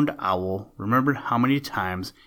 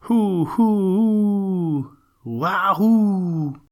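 high, loud hooting owl call, long and drawn out; 9 semitones higher, 6 dB louder, much slower than usual. A narrator's reading. Horned owl calling loudly to his cousin, high drawn-out hoot. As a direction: hoot it out like a big owl calling across the woods. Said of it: "called loudly".

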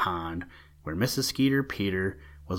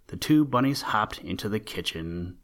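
plain reading voice

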